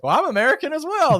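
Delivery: high, loud sheepish reply to tourists; amused admission. Retold or imitated. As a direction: laugh it off to fellow tourists. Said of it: "I'm like".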